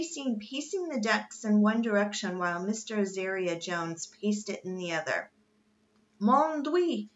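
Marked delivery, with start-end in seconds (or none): 6.2-7.1 put-on high-pitched French-accented dismay; theatrical French outburst, pitch raised; French exclamation. A narrator's reading. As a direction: cry out as a distressed Frenchman. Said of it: "declared the Frenchman".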